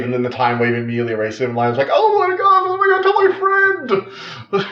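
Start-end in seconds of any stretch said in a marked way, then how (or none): 1.9-4.7 high, cracked hammed-up sobbing; mock wailing. Lila pretending to grieve the vanished boy, mock crying. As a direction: wail it like a fake breakdown.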